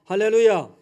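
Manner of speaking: loud ringing hallelujah; shouted hallelujah. Real, not acted. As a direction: call it out to the congregation. Preacher's acclamation. (회중에게 외치는 할렐루야)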